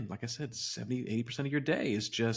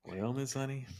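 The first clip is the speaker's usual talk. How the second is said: softer Southern accent, put on; Georgian accent; 3 semitones higher, 6 dB quieter than usual. Co-host obliging the request, soft Georgian accent. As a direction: play it gently Georgian. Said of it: "in your Georgian accent".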